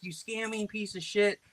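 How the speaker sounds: high, mock insult; put-on prank retort. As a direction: throw the insult in a silly high voice. His retort to the scammer.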